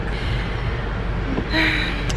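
a long tired sigh; weary sigh. Real, not acted. Lost luggage, vlogger sighs wearily. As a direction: let out a heavy sigh of defeat.

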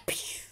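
whispery whooshing "pew"; imitated blast noise. Player voicing his own attack, blast noise. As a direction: make a voiceless shot sound.

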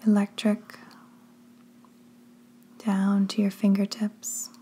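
slow, hushed delivery in short phrases with long pauses; drawn-out words, each phrase left to settle; guided sleep meditation. Acted. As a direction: lead them gently down into sleep, unhurried.

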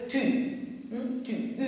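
"tu" and "u" with the thin French ü; "tu", "u" said with lips rounded on an ee sound. Shown as a demonstration. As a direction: show the rounded front vowel in "tu", then alone as "u". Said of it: "όπως το γαλλικό tu".

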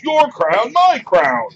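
high, loud, indignant squabbling shout; Ettin head retorting. Acted. Second Ettin head disputing the crown with the first, loud indignant retort. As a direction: fire back an outraged monster retort. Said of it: "The other head retorts".

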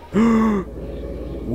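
high, drawn-in gasp; startled gasp. Real, not acted. Player startled by something in the game, sharp gasp. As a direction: suck in air in surprise.

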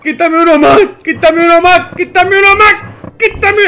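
real panic building to a high yell; rising frantic plea. Real, not acted. Tense moment, begging the game.

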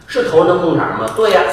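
normal talking voice

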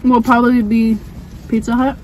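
speaking between bites of wings; slightly muffled chewing speech; talking with food in mouth. Real, not acted. Seen 1: Bites wing just before; speaks holding it, mouth likely still partly full.

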